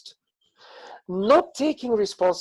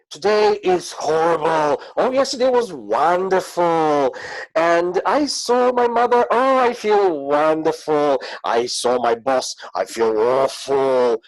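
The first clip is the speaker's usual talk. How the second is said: louder, swinging between gushing and gloom; moody person; 4 semitones higher, 5 dB louder than usual. Retold or imitated. Imitating a person with all-or-nothing moods, louder mock swings. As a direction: act out someone whose days flip from wonderful to awful. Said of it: "people who have this kind of opposite things happening".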